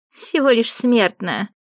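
high, slow disdainful sneer. Acted. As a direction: spit out the contempt. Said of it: "скривилась та".

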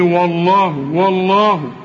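والله opening on a thin w; light w. Shown as a demonstration. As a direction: recite والله slowly with a light first w. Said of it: "وبتقول لي". (ترقيق الواو في والله)

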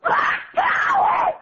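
high, slow shouted chant; screamed slogan. Real, not acted. Woman shouting on the caller's orders.

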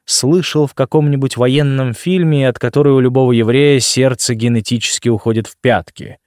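usual speaking voice

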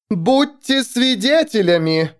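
high, slow, ringing declaration; thundering proclamation. A narrator's reading. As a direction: thunder it out to the crowd. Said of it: "прогремела она".

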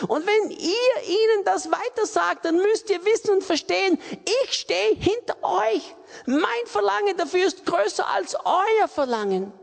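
high, loud, voice near tears; tearful preaching. Real, not acted. Preacher moved to tears before the congregation, tearful. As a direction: preach through real tears.

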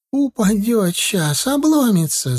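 high, drawn-out village lament; wailing old woman. A narrator's reading. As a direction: wail slowly in alarm like an old peasant woman. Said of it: "запричитала пожилая колхозница". (причитания пожилой колхозницы)

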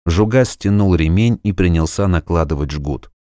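ordinary delivery

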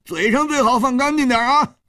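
a flustered man blustering high; flushed indignant. Acted. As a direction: stand up to him, face burning. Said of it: "涨红了脸说".

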